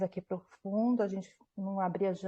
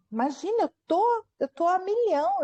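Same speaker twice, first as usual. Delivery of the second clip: retold agitation, pitch jumping high; wired, restless; 9 semitones higher than usual. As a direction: insist you're far too keyed up, voice leaping up. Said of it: "Eu tô que nem uma pilha aqui". (agitada, a milhão)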